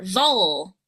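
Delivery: "Vul" lifted then gliding down, held long; long stressed syllable. Shown as a demonstration. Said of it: "voice to go higher, louder, and longer".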